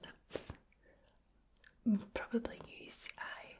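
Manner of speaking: whispered Glasgow Scots, rolled R's; soft Glaswegian ASMR whisper; Glaswegian accent. Shown as a demonstration. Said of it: "So the Glaswegian accent is one that".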